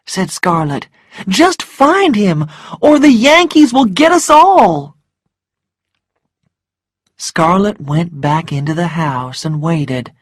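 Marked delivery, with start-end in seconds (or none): 1.2-5.0 high, loud, desperate order; high-pitched frantic urging; urgent plea. Acted. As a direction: plead loudly with rising panic. Frightened woman urging a servant on.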